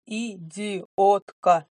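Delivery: Идиотка spelled out slowly, syllable by syllable, loud. A narrator's reading. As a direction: spit out Идиотка one syllable at a time. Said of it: "по слогам произнёс он".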